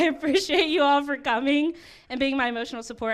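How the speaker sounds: usual speaking voice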